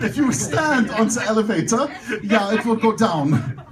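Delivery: hammed-up German accent; thick German accent. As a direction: imitate a German woman's accent. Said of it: "with a very thick German accent".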